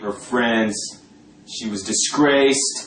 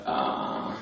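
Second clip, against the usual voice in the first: dismissive uh; 10 dB quieter than usual. Retold or imitated. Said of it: "they're just like".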